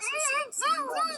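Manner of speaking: high squeaky voice. Acted. Repeated in higher voice.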